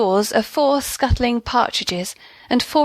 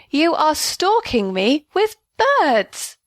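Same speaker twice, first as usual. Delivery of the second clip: higher, slowed, furious accusation; furious yell; 6 semitones higher, slower than usual. Acted. Furious woman yelling at her ex about the birds. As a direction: spit it at him like an outraged ex. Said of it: "I yelled".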